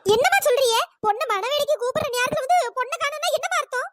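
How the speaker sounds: high, agitated demand for answers; angry, agitated. Acted. Groom's mother demands explanation for missing bride, angry and agitated. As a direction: confront them in rising alarm. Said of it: "மரகதம், கோவப்படாதம்மா, கொஞ்சம் பதட்டப்படாம".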